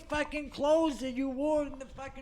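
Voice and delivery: loud, angry father; angry shout. Retold or imitated. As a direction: yell at your son. Father yelling at son.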